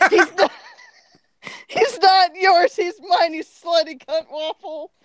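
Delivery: high, loud jealous outburst; high-pitched mock-furious cry; screamed retort. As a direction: scream it in hammed-up fury. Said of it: "I screamed and punched him".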